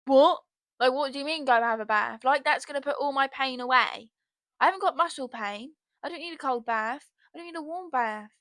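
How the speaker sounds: loud, incredulous outburst; exasperated rant. Real, not acted. Podcaster ranting at adults who say have a bath, loud and exasperated. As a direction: blow up at the useless advice. Ranting about being told to bathe.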